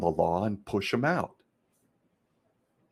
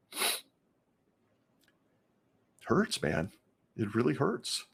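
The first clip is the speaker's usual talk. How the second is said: holding back real tears; choked up; 2 semitones higher, slower than usual. Real, not acted. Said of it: "God, I'm getting emotional talking about this".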